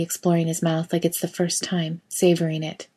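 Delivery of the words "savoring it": ordinary delivery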